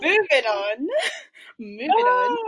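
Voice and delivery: giggling through it; laughing. Real, not acted. Laughing off the slang.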